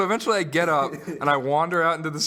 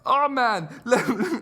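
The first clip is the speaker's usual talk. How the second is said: higher, badly faked Jamaican accent; attempted Jamaican accent; 7 semitones higher, slower than usual. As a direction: have a clumsy go at a Jamaican accent. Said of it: "he had a Jamaican accent".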